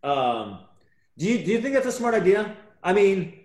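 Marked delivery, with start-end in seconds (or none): 1.2-3.4 tipsy and pushy. Real, not acted. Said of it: "okay, I'm drunk".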